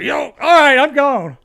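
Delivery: high, loud hasty surrender; scared backing off. Retold or imitated. Stepdad backing down from a gun in his face, high and loud. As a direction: yelp it out and bolt. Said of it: "And he's like".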